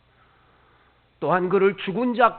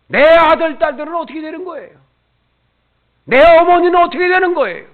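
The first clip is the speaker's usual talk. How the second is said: loud, much higher anguished questioning; impassioned plea; 9 semitones higher, 14 dB louder, faster than usual. Acted. Preacher pleading for the congregation's families, loud. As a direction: cry out for each family member.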